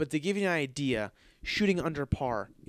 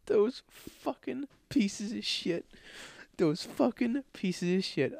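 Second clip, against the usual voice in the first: seething curse; 7 semitones higher, slower than usual. Retold or imitated. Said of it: "And I'm like".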